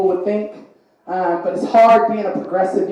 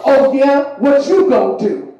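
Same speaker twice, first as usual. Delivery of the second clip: taunting challenge; 6 semitones higher, 7 dB louder, slower than usual. Retold or imitated. Tough guy challenge.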